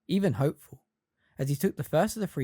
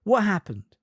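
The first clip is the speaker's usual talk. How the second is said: put-on worried girl's voice; 8 semitones higher, 4 dB louder than usual. A narrator's reading. Hermione questioning anxiously.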